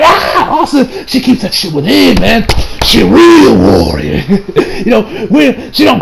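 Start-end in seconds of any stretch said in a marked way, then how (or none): 0.0-0.5 high yelped "Ah!" of pain; mock pain cry. Mocking Kirito's scream when slashed, a high yelped "Ah!". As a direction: yelp like a wounded swordsman. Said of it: "crying out in pain".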